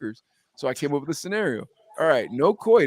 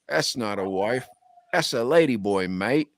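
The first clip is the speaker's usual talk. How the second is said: put-on low-pitched, light Australian accent; 5 semitones lower than usual. Reading out the next comment, Australian accent. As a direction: do a mild Aussie accent, pitched down. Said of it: "I say it in an Australian accent".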